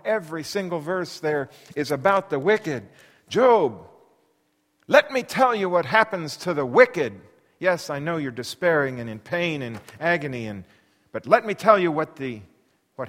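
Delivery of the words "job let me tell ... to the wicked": louder, insistent warning; stern warning. Acted. Bildad warning Job again, loud insistence. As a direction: call his name and drive it home.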